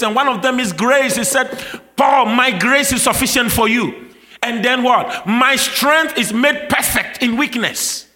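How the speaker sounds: loud, high, driving sermon voice; impassioned revival preacher; full-force preaching. Acted. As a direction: preach it out with rising fire to the crowd.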